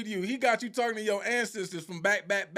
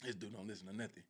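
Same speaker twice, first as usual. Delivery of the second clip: much lower and far softer, a fast grumble; muttered aside; 11 semitones lower, 19 dB quieter, faster than usual. Retold or imitated. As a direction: grumble it under your breath. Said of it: "the dude over there just like".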